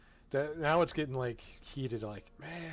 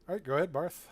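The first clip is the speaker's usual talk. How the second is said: ordinary delivery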